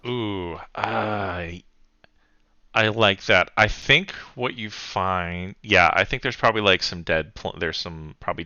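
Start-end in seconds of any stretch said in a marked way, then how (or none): none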